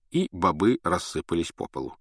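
ordinary delivery

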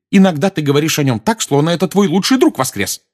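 high, loud reproach; heated accusation. A narrator's reading. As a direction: accuse with rising agitation. Mrs Weasley's outburst at Sirius. (с громким упрёком)

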